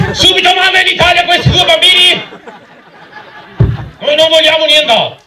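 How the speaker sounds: squeaky hammed-up parent; high mock parent voice. Mocking German parents' reaction.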